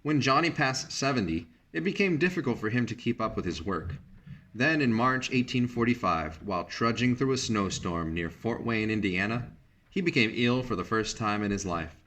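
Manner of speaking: ordinary delivery, nothing marked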